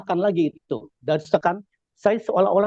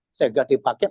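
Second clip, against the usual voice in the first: normal talking voice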